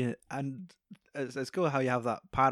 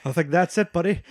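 louder, higher, near tears; a washed-up actor giving up, voice cracking upward; choked-up Rick; 5 semitones higher, 9 dB louder, faster than usual. Retold or imitated. Rick admits he's finished, loud and near tears.

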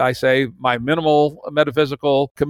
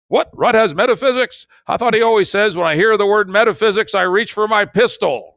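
higher and louder, imitating a startled listener; mock listener surprise; 8 semitones higher, 4 dB louder than usual. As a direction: play a surprised fan catching him out. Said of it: "those people listen to this show know".

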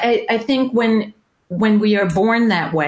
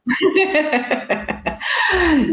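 high laugh, falling at the end; delighted laugh; 10 semitones higher than usual. Real, not acted. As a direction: laugh at the happy coincidence. Both share the feisty label.

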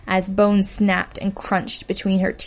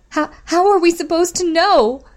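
much higher, louder, pleading; plaintive raised voice; 10 semitones higher, 5 dB louder than usual. A narrator's reading. Injured woman pleading with Bitch, plaintive and raised. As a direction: raise your voice, begging to be understood. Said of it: "raised her voice, sounding plaintive".

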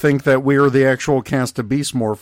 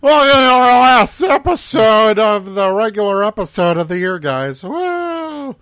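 hyped host voice; 8 semitones higher, 5 dB louder than usual. Pretend. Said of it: "No, I'm not like that".

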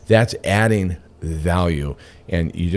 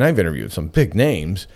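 ordinary talk, nothing marked

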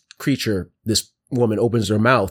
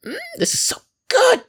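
gleeful granny voice; 6 semitones higher than usual. Acted. Grandma creature relishing.

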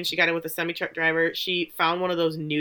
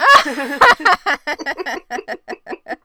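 big high laugh that breaks out; real loud laughter at her own joke; loud burst of laughter; 9 semitones higher, 8 dB louder than usual. Real, not acted. Laughing hard after guessing the purple people eaters.

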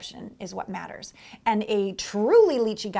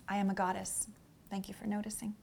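much softer, calm self-affirmation; quiet inner affirmation; 3 semitones lower, 13 dB quieter than usual. Shown as a demonstration. Coach shows the silent goddess mantra, very softly. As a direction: say it softly to yourself. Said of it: "you affirm, inside".

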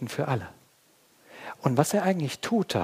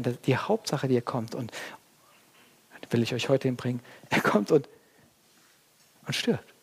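ordinary talk

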